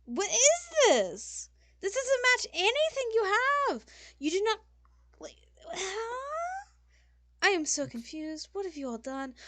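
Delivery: very high, loud diva outburst; scandalised designer, pitch soaring; outraged fashion designer. Acted. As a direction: shriek in theatrical horror at the fashion crime.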